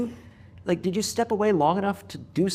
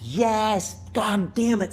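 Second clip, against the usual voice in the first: higher, exclaimed yes; emphatic agreement; 5 semitones higher, much slower than usual. Real, not acted. Host seizing on the guest's answer, emphatic agreement. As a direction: burst out in heartfelt agreement.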